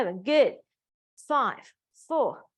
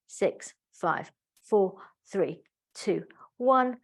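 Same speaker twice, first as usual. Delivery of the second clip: usual speaking voice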